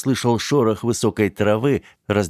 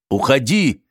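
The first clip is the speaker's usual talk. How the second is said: sharp order; 7 semitones higher, 4 dB louder than usual. Acted. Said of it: "резко сказал он".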